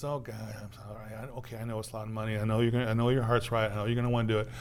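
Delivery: softened king relenting. Retold or imitated. The king giving in to the debtor, quiet and hurried. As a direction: cave in quietly out of pity.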